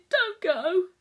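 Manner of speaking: high, loud plea breaking into a cry. Acted. Boy begging again as he is pulled away, crying. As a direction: sob the words out, begging.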